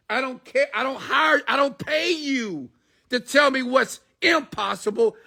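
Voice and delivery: Steve Jobs furious. Retold or imitated. Steve Jobs berating his programmers, an angry high-pitched rant. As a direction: chew out the doubters, voice way up. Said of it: "He said".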